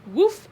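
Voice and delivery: high, loud "woof" of a blow to the gut; winded grunt. A narrator's reading. Victor takes a hit to the middle, winded grunt. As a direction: grunt as if punched in the belly. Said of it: "Victor grunted and sat down".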